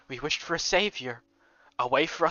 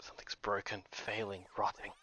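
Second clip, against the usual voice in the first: whispered, low and much softer; eerie whisper; 7 semitones lower, 13 dB quieter than usual. Acted. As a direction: breathe the words like a haunting echo. Eerie echo of earlier line.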